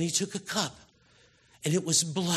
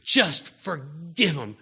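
slow, louder; slow, louder plea to the congregation; 6 dB louder, slower than usual. Real, not acted. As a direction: plead it slowly. Preacher urging forgiveness.

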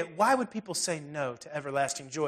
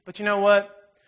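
normal talking voice

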